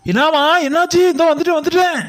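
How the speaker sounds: high, loud alarmed reply; alarmed father. Acted. Father answers his daughters' cries, high and loud. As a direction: rush in worried, calling back high.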